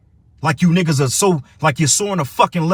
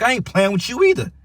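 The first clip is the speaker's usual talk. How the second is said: higher, fired-up comeback; heated retort; 8 semitones higher than usual. Real, not acted. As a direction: throw the words straight back at him. Angry retort to disrespectful caller.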